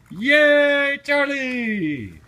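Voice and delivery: high, loud yay; excited cheer. Real, not acted. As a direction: cheer like they just won. Celebrating toddler's right answer.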